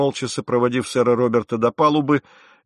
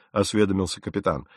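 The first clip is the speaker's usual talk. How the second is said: ordinary delivery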